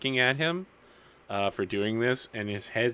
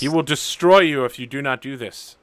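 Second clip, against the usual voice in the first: raised, louder in-character threat; urgent warning; 4 semitones higher, 7 dB louder than usual. Acted. Uncle warning Everyman he will be destroyed, urgent insistence. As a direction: press the warning hard and dramatic.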